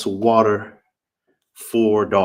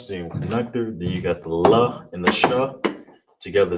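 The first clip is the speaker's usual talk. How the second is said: normal talking voice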